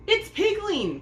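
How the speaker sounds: Peony telling on her sister; tattling. A narrator's reading. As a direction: point and squeal it out. Sister tattling on Pear Blossom.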